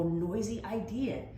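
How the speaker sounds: ordinary delivery, nothing marked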